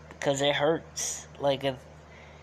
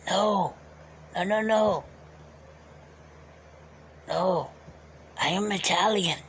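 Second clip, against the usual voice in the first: put-on old Uncle Alfonso voice. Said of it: "that was a long while talking like him".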